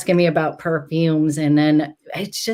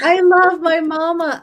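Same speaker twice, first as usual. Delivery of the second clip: tearful; 10 semitones higher, 3 dB louder than usual. Real, not acted. Son says hello, mother tearful.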